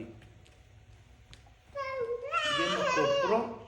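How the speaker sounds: high, drawn-out laughing words; laughing with joy. Real, not acted. Preacher laughing as he tells how the man bought it for them, slow and high. Joyful payoff of a testimony.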